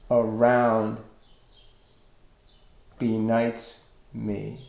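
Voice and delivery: slow verse with pauses between the feet; scanning the meter. Shown as a demonstration. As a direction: draw out each beat, letting the line ends fall away. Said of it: "you can see that the stressed syllables".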